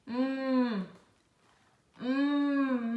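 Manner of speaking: "Hum" as a long "mmm"; isolated M sound. Shown as a demonstration. Teacher showing the M sound, a held "mmm". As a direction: hum with lips closed, no vowel. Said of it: "de hum".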